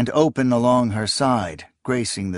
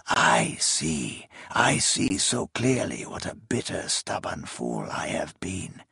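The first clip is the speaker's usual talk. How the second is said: dying whisper; 4 semitones lower, 8 dB quieter than usual. Acted. Dying king, soft and broken.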